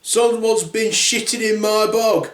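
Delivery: a lightly acted indignant proclamation; Daddy Bear. Father bear at the big toilet, indignant. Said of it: "Daddy Bear goes upstairs to the big toilet and says".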